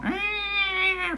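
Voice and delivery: high, drawn-out cat whine; imitated meow. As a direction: imitate a cat's long nagging meow. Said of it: "She usually starts going".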